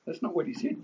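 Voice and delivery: ordinary talk, nothing marked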